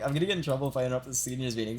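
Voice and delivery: rattled off quickly; stressed rush. Retold or imitated. Rushing through the retold excuse, stressed. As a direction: blurt it out in a fast rush. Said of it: "I was so fucking stressed".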